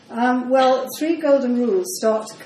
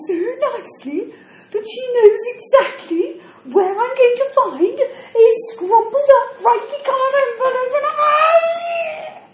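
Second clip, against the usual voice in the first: flustered, laughing and near tears by turns; a bewildered woman panicking over her lost memory; rising distress, high and breaking; confused old woman; 7 semitones higher, 5 dB louder than usual. Acted.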